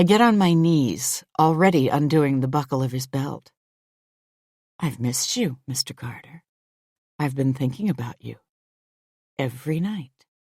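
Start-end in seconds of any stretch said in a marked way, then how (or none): 4.8-10.3 hushed, intimate murmur; seductive whisper. A narrator's reading. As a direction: whisper it close to his ear.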